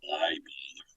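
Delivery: low, soft, mopey whisper; Eeyore voice. Shown as a demonstration. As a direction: sigh it out like a gloomy donkey. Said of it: "Eeyore is just a low, depressive voice".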